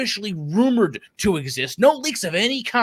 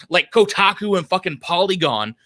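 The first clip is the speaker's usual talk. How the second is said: usual speaking voice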